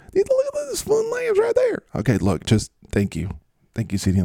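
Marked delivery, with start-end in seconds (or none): 0.1-1.8 falsetto squeal; C D Lamb. Acted. C D Lamb points at fun lambs, squealing. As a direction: squeal eagerly, far above normal pitch. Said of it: "I'm C D Lamb".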